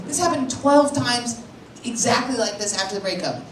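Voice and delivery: ordinary talk, nothing marked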